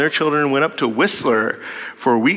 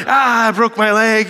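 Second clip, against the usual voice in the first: slow, mock-dramatic cry of pain, a bit higher; dramatic child wail; 5 semitones higher, 3 dB louder, slower than usual. Dad imitating his daughter's dramatic fall. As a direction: ham up a kid's fake injury. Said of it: "this dramatic thing".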